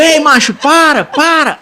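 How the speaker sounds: loud high call to stop; shouting stop at a fleeing thief; retold shout. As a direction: yell at him to stop, half laughing. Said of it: "eu digo".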